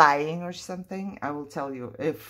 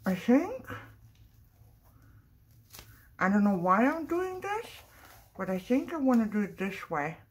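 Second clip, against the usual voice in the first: talking around a brush in mouth; 4 semitones higher than usual. Real, not acted. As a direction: speak with a brush between teeth. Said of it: "Sorry, I had a brush in my mouth".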